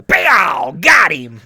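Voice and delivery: high, loud ringside yell; excited shout. Pretend. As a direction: shout it like a fight commentator. Calling out a gizzard fight.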